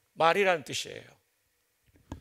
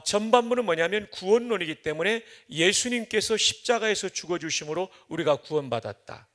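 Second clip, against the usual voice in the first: ordinary talk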